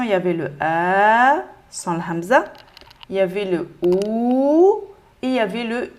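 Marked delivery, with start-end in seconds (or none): 0.6-1.4 long held "A" with a rising end; prolongation. Shown as a demonstration. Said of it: "les lettres prolongation". 3.8-4.8 long held "OU", gliding up; prolongation. Shown as a demonstration. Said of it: "les lettres prolongation".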